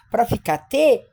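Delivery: normal talking voice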